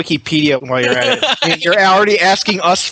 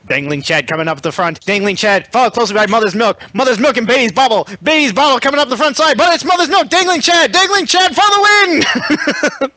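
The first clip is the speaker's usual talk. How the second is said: racetrack announcer; 6 semitones higher than usual. Retold or imitated. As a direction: race caller building higher and more frantic. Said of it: "He sounds like the racetrack announcer".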